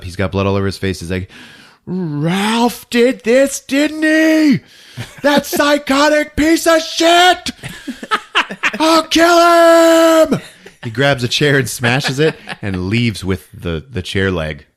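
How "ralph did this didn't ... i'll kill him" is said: high, loud, drawn-out enraged yell; furious screaming. Retold or imitated. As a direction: scream vengeance, stretching the words. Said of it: "he screams and he gets up".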